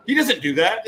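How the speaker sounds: defensive. Retold or imitated. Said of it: "he's like".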